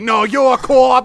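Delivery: high shouted gangster line; Departed improv shout. Acted. Friend improvising a Departed scene, shouting. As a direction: yell it like a movie tough guy. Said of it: "That was some Departed, improv".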